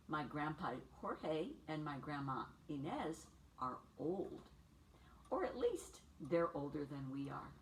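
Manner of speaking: lively picture-book read for little ones; bright storybook read-aloud for preschoolers; reading to children. A narrator's reading. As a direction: read it like story time on the rug.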